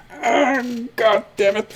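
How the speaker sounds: half-hearted frustrated sigh; exasperated. Retold or imitated. Memory voiced as exasperated.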